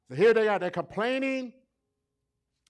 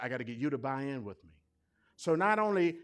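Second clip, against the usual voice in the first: usual speaking voice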